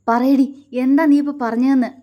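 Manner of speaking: husband gripping her face and yelling; demanding shout. A narrator's reading. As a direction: force the answer out of her.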